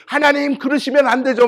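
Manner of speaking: high, loud retold objection; heated protest. Pastor objecting to God's verdict, worked-up protest. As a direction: object loudly as if wronged. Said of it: "하나님 앞에서 막 항변을 했어요".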